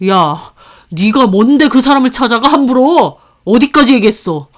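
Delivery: loud, demanding, pressing her hard; angry interrogation. Acted. Husband grilling his wife over what she told, loud and angry. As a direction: bark the questions at her. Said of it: "하면서 따지는데".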